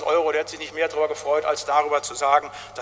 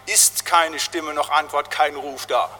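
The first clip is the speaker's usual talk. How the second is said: normal talking voice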